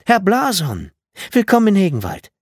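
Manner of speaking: high, loud, delighted greeting; effusive welcome. A narrator's reading. Mother greets the trainer at the bus, effusive welcome. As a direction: call out a grand welcome to a guest.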